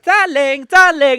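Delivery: high, loud camp gushing; mock camp greeting, very high and loud; camp darling. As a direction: gush it out like a camp showman. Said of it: "He'll be like".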